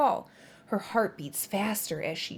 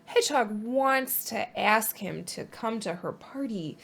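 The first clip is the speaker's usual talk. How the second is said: ordinary delivery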